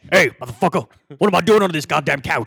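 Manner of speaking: loud, tough, swaggering yell; aggressive Mister T voice. Retold or imitated. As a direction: bark it like a tough guy. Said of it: "It sounds aggressive".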